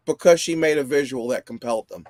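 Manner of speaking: usual speaking voice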